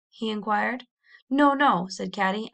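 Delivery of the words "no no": urgent denial. Acted. Caddy urgently denies.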